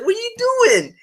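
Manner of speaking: very high squeaky quote; high mock Comey. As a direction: squeak it out in panic.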